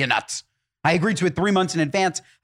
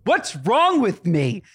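high, slow indignant insistence; indignant correction; 6 semitones higher, slower than usual. Retold or imitated. Telling the coordinator off about the car colour, high indignant. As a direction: correct her with mock outrage. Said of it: "swear to God, I go".

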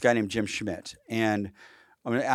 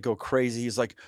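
ordinary talk

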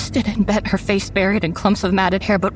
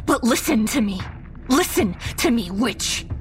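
trembling, defiant voice; shaky vibrating voice; 4 semitones higher than usual. Acted. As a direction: voice shaking with fear and fury. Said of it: "my voice is shaking so much".

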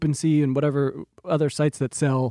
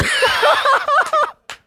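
loud, high laugh burst; high burst of laughter; 4 dB louder than usual. Real, not acted. Laughing at reply tweet.

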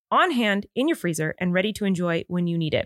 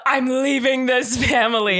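slow, a bit louder mock yell of a dramatic exit; 3 semitones higher, 4 dB louder, slower than usual. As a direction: replay the husband's yell half-joking, drawn out. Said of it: "because you yelled it".

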